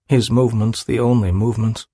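usual speaking voice

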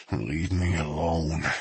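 low, slow, teeth clenched; through clenched teeth. Acted. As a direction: grit it out slowly. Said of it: "through clenched teeth".

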